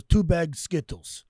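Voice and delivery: slow, clipped-off Russian accent, imitated. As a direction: do Dimitri's Russian accent, slowly and deadpan. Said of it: "says in his Russian accent".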